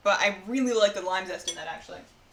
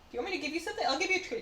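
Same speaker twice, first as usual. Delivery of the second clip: pet talk; 7 semitones higher, much faster than usual. Real, not acted. Offering dog a treat.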